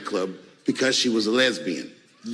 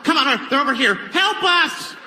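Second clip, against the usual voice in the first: frantic stranger begging for help, much higher; mock panic; 9 semitones higher than usual. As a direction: plead breathlessly to an officer. Panicked stranger asks cop for help.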